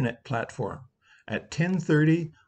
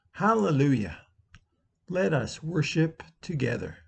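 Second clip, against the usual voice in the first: liturgical call. Acted. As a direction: proclaim each line like a litany. Easter call to worship liturgy.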